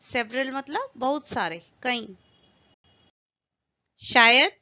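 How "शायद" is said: announcing word. Shown as a demonstration. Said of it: "first take of the drill word".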